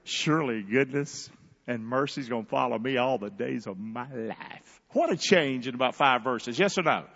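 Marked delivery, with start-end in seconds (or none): 0.0-4.8 quiet confidence after the lament; calm assurance. Retold or imitated. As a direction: let the verse settle in peace. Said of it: "just a few verses later".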